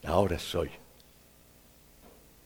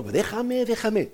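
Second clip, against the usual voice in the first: pained teacup; 10 semitones higher, slower than usual. Acted. Teacup begging potter.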